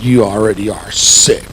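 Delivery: low, grumbled retort; retold angry mutter. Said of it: "I just muttered and I said".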